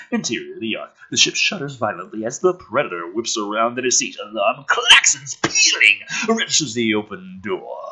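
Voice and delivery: ordinary talk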